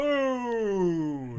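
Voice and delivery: loud, high battle cry; the Tick. Retold or imitated. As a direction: bellow it like a superhero. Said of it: "in the Tick T V show".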